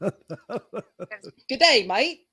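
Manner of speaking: put-on light Australian accent, laughing; a quick, playful Aussie greeting; mock Australian. Host tries a mock Australian accent on the guest. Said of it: "I can do a number of different accents".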